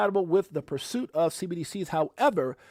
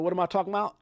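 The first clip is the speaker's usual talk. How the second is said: ordinary delivery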